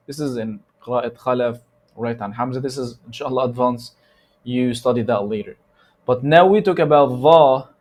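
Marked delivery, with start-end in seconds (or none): none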